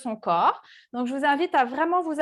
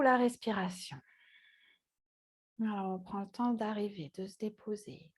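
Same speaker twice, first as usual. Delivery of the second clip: guided meditation; 4 semitones lower than usual. Acted. Yoga teacher leading seated students into body awareness, calm meditative guiding. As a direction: guide them calmly into the breath, with long pauses.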